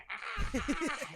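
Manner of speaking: laughing mock scream. Mimicking a screaming crowd, laughing.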